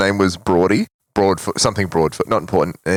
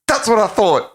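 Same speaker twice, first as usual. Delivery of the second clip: smug retort; 14 semitones higher than usual. Retold or imitated. Retold triumphant retort.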